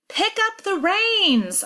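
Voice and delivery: high, drawn-out shouted command; slow, high-pitched yell. A narrator's reading. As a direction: shout it urgently, stretching the words. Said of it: "I shout".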